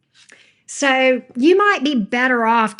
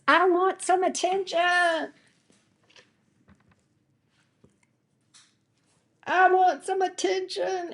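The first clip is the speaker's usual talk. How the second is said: much higher, small pleading dog voice; voicing the dog; 8 semitones higher, 4 dB quieter than usual. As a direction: speak for the dog begging for attention. Voicing the dog's wish.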